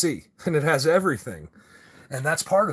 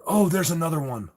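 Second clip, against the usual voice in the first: normal talking voice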